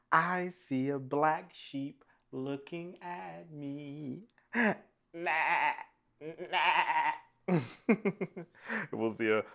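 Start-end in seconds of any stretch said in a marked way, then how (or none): 0.1-4.2 drawn-out, lilting answer line; half-sing the line to little listeners; sing-song story reading. Acted. Reader answering the book's question for children, sing-song. 5.1-7.1 wavering playful baa; sheep bleats. Pretend.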